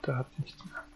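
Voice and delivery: hushed whisper. Real, not acted. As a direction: whisper it under your breath. Quietly looting a grave in game.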